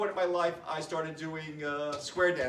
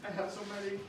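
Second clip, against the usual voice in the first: quiet, emotion catching in the throat; apologizing while welling up; choking up; 4 semitones higher, 9 dB quieter, faster than usual. Real, not acted. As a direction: voice softening as the grief hits. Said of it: "I'm sorry".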